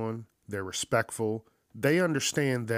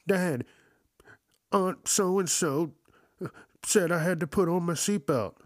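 put-on higher, whining child voice, dragged out; whiny kid; 7 semitones higher than usual. Kids whining to dad on the phone, high and whiny. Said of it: "And they're like".

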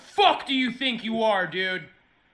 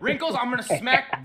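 usual speaking voice

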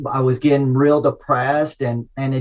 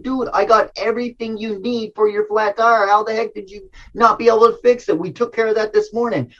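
high-pitched exasperated scolding, retold; a father fed up with his daughter's flat tire; exasperated dad; 8 semitones higher than usual. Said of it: "And I said".

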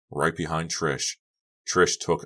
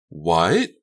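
raised voice, not catching it; loud, rising query; loud puzzled question; 6 dB louder than usual. A narrator's reading. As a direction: call out what over the noise. Unable to hear over music.

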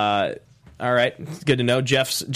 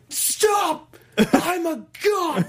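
high, desperate shrieking cry; panicked shriek; much slower than usual. Retold or imitated. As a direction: shriek like a terrified god being smashed. Imitating Loki being ragdolled.

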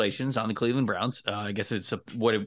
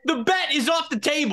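high-pitched outburst, half laughing; amused, worked-up declaration; excited exclamation; 13 semitones higher than usual. Real, not acted. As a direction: call it out in gleeful protest. Mock outrage over a bet.

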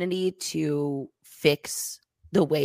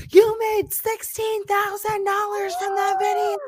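high loud accusing shout; angry shout; 11 semitones higher, 4 dB louder than usual. Retold or imitated. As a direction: call her out, shouting high. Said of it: "he goes".

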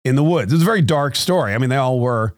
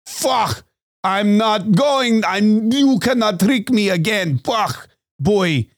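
slow, higher-pitched indignant German craftsman; mock German accent; 7 semitones higher, much slower than usual. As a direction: refuse in a hammed-up German accent. Fed-up German stein maker voiced.

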